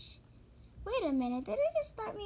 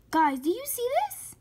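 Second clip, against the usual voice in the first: excited; 9 semitones higher, 8 dB louder, faster than usual. Real, not acted. Showing viewers a surprising find.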